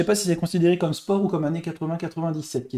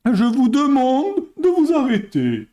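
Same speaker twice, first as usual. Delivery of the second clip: a hint of Balladur's solemn plea, a touch higher; bad Balladur impression; 6 semitones higher, 3 dB louder than usual. Streamer doing a bad Balladur imitation, mock solemn. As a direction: lightly mimic the politician asking for calm. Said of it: "j'ai fait une très mauvaise imitation".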